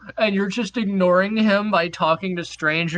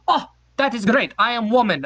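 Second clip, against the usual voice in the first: lightly put-on Norwegian accent, a bit higher; mock Norwegian accent; 5 semitones higher, 3 dB louder than usual. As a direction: play the Norwegian stranger in broken English. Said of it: "this accent is".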